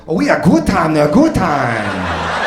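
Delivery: lightly put-on Cantonese-English accent; a hint of a Hong Kong accent; mock Hong Kong accent. Comic back from Hong Kong, mock Hong Kong accent. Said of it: "come back from Hong Kong going".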